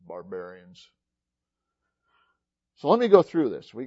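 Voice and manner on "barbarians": low, hushed imitation of looking down on people; mock disdain. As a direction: drop low and soft, as if dismissing them. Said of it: "He looked down on those people".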